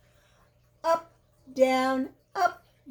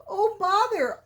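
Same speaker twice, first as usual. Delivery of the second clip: distressed bother; 9 semitones higher than usual. Acted. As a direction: squeak it out while stuck. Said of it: "said Pooh".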